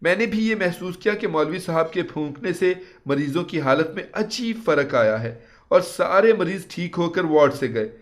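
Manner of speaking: ordinary delivery, nothing marked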